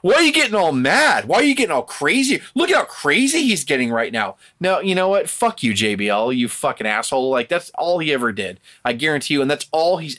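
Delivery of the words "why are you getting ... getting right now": much higher, louder, put-on incredulous whine; mock faux-shocked bully. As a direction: play the bully acting shocked at your anger. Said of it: "They're like".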